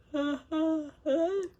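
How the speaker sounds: loud high burst of laughter. Real, not acted. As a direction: break into real laughter.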